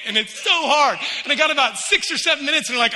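ordinary delivery

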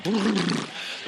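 imitated sputtering tongue trill; tongue-flapping noise. Believer waiting for something to grab his tongue, mock sputter. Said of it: "grab my tongue and go".

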